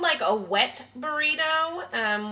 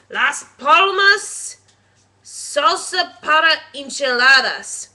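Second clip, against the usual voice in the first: "Las Palmas salsa para enchiladas" said loud and slow with a Spanish accent; loud, slow Spanish-style reading of "salsa para enchiladas"; Spanish pronunciation; 9 dB louder, slower than usual. Shown as a demonstration. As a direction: roll out "Las Palmas" like a proud Spanish speaker. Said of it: "Ooh, that was pretty good".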